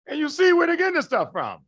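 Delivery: excited shout. Real, not acted. Lecturer exclaims to class.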